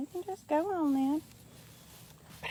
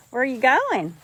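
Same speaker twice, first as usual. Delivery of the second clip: ordinary delivery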